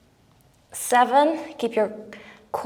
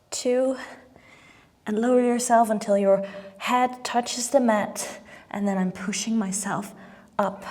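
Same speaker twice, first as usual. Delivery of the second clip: ordinary delivery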